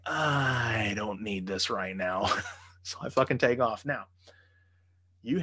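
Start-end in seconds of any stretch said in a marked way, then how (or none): 0.1-2.3 slow, drawn-out mock whine; exasperated groan. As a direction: groan it out, fed up. Retold exasperation at her threat.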